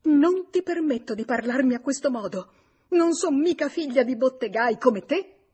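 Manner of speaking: high, loud, haughty protest; theatrical outraged retort; tragic indignation. A narrator's reading. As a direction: draw yourself up and rebuke loudly. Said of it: "Lei eresse il busto tragica".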